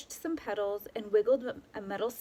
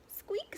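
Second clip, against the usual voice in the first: tiny high squeak, softer; mouse squeak; 11 semitones higher, 8 dB quieter than usual. A narrator's reading. Mouse answers with a soft high squeak. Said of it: "said Mouse".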